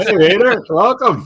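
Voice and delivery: high, loud, laughing welcome; excited welcome. Real, not acted. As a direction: greet the guest with delight. Welcoming the guest.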